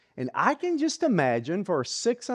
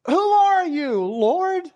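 desperate cry; 10 semitones higher, 3 dB louder, slower than usual. Acted. Saul cries out to the voice.

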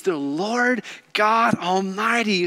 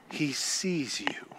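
soft breathy whisper, slowed down; hushed whisper to the congregation; 7 semitones lower, 13 dB quieter, slower than usual. Real, not acted. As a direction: lean in and whisper it like a secret. Intimate aside in sermon.

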